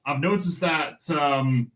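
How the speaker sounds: usual speaking voice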